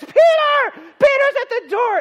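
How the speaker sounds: very high, loud cry of news; excited shout. Acted. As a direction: yell it to everyone in the house. Loud crowd at the door.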